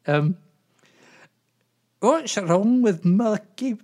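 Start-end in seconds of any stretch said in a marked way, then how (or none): 2.0-3.8 slow, high-pitched attempt at Scottish; Scottish accent. [name] starting a joke for Doris, bad Scottish accent. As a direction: put on a shaky-authentic Scottish accent. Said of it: "in a Scottish accent".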